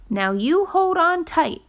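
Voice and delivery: a small creature giving firm orders; Fletcher's voice. Acted. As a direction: Fletcher tells the leaf to hold on. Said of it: "said Fletcher".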